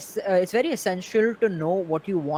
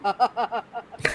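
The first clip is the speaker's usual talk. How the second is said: a quick amused laugh; short laugh; 2 semitones higher, 3 dB quieter than usual. Real, not acted. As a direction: laugh briefly. Laughs recalling recording.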